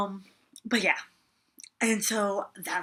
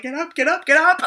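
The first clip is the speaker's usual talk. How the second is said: higher and much louder, frantic; panicked pleading; 7 semitones higher, 11 dB louder than usual. Retold or imitated. To Garrett on one knee, a frantic high-pitched plea. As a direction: repeat it faster and more frantic each time.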